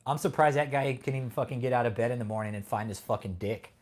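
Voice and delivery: ordinary delivery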